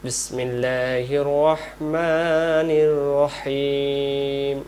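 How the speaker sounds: slow, drawn-out chanted recitation; Quran recitation. Shown as a demonstration. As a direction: recite it slowly in tajweed. (ধীর তিলাওয়াতের সুরে)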